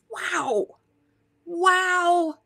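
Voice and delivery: high awed exclamation; breathless with wonder; awed wow. Real, not acted. Awed by the cosmic ripple.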